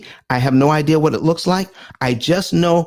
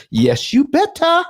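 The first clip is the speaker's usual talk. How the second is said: playful insistence; 13 semitones higher than usual. Real, not acted. Host teasing viewers who must register, high and sassy. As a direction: sing it higher and teasing, "better" leaning down.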